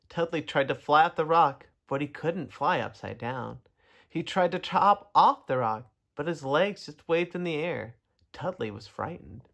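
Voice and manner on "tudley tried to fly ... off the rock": plain reading voice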